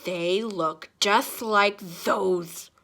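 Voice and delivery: put-on very slow, lightly growled old-man suspicion; mild suspicious growl. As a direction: accuse him slowly. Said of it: "growled suspiciously".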